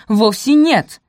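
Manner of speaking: high, loud denial; sharp contradiction. A narrator's reading. Araminta denying her daughter's claim, sharply contradicting. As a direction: object forcefully. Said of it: "возразила, тем не менее, Араминта".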